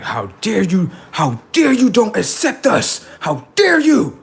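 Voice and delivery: high, indignant, rising fury over "How dare you"; shrill outrage at being rejected; offended outrage. Retold or imitated. Spirits outraged he won't accept them, high indignant voice. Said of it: "They got very upset".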